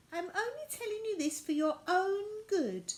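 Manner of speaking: high, firm little rag-doll lecture; prim doll voice scolding the children; Violet's know-best advice. Acted. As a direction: talk down to the children for their own good.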